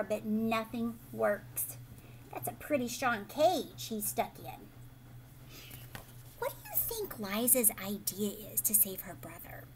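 usual speaking voice

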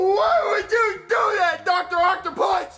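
high wailing sob-shout; crying shout. Acted. Child hit by dad, crying shout. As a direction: wail it out, hurt and betrayed. Kid hurt in fight.

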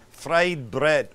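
"Fried bread" in a botched put-on accent; wrong accent. Shown as a demonstration. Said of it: "Oh, wrong accent".

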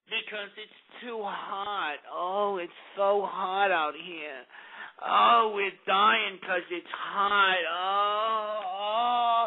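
slow, drawn-out mock moaning from the heat; mock dying moan. Mocking the heat victims with a slow wilting moan.

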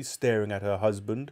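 ordinary delivery

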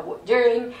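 lightly voiced Cockney cabbie; a hint of comedy London Cockney; Cockney taxi driver. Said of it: "Cockney accent now".

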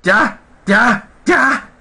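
high frightened shouts of ya; scared shouting at the screen; panicked yelling. Real, not acted. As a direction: yell in real fright, voice jumping up. Startled by the monster in game.

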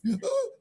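sharp gasp. Acted. Man cries out in distress.

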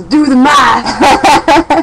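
drawn-out playful shout. Real, not acted. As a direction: yell it slowly, teasing. Mock exasperated at mom's age.

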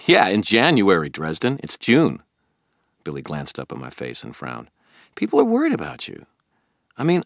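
a puzzled, keen friend pressing Harry; Billy the eager friend. Acted. Billy questioning Harry about the toads, puzzled and keen.

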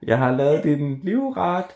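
high, slow, drawn-out call from afar; mother calling. A narrator's reading. Mum calls Kaj home for dinner, high and drawn out. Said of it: "morens stemme igen".

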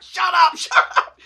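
retold frantic silencing; urgent shushing. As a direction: cut him off in a strained high voice. Girlfriend nudges, silences his rambling.

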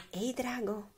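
soft, hushed call; tender, quiet storybook call; very gentle whisper. A narrator's reading. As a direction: whisper it sweetly to a sleeper. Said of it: "sussurrò molto dolcemente".

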